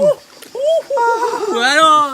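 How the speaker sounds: high drawn-out cry of cold shock; in ice cold water. Real, not acted. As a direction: wail as icy water hits. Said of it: "Froide, hein?".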